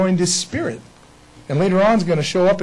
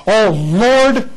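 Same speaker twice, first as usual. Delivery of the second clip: mock exclamation; 6 semitones higher, 7 dB louder than usual. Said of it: "they would tell us that he said".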